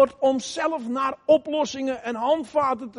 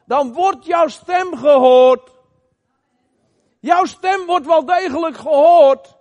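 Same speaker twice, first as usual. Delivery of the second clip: louder preacher's voice, urging the congregation; fervent preaching; 3 semitones higher, 5 dB louder than usual. Acted. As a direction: press it home to the church, with conviction.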